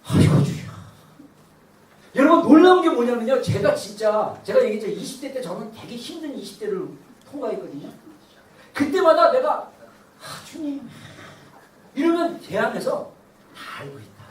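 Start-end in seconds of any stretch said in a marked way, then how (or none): 0.0-0.7 breathy, unpitched sigh of lament to God; heavy demonstrated sigh. Shown as a demonstration. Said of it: "탄식". 10.2-10.9 soft, sighed plea to God; weary prayer. Retold or imitated. Re-enacts his weary prayers in twenties.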